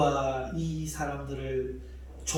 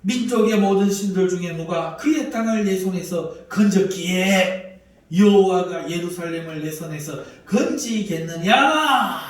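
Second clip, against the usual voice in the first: arrogant mockery; 3 semitones higher, 8 dB louder than usual. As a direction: sneer at the LORD with a laugh. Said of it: "교만한 이야기를".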